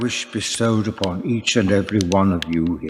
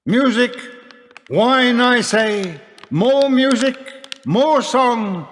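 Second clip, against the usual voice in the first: raised, high and ringing, calling the hall to revel; a lord's booming cheer to his guests; jovial call for music; 11 semitones higher than usual. Acted. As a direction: toast the feast, voice lifted high over the crowd. Lord calls for festivities.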